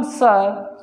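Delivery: "सर" as a long suh; drawn-out British "सर" without r; silent r. Shown as a demonstration. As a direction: hold the vowel of "सर", no r. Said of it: "र नहीं बोलते".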